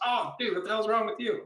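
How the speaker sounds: put-on high joking outrage at an old classmate; mock disbelief. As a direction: pitch it way up like a buddy ribbing you. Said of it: "He goes".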